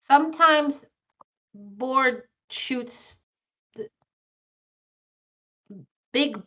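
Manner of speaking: halting mouse speech, word by word; long gaps between single words; trouble making words. Acted. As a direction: grope for each word like a simple-minded mouse. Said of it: "the mouse is having trouble making words".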